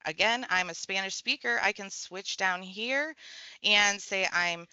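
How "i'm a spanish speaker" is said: ordinary talk, nothing marked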